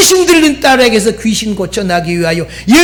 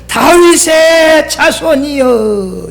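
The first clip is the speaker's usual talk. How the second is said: higher, slow drawn-out call; retold crying out; 7 semitones higher, much slower than usual. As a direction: imitate blind men calling to Jesus. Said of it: "소리쳤더니".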